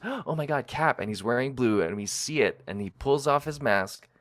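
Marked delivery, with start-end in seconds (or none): 0.0-1.0 retold gasp of recognition. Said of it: "and he goes".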